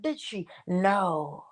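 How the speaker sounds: ordinary talk, nothing marked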